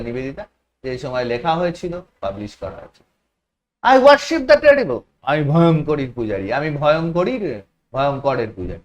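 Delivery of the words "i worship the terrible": higher, louder and slow; emphatic reading. Shown as a demonstration. Teacher reading Vivekananda's words to students, emphatic and slow. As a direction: proclaim the line slowly.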